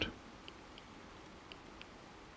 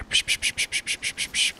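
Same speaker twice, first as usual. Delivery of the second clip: soft hissing psch sounds, unvoiced; whispered psch noises; 7 dB quieter, faster than usual. Gamer mimicking silenced shots in a whisper. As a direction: hiss out little gunshot noises.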